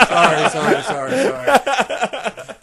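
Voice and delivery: real laughter breaking through sorry, sorry; can't stop laughing while apologizing; apologizing through helpless laughter; laughing apology. Real, not acted. Laughing apology after blurting out.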